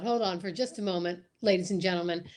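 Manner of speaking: normal talking voice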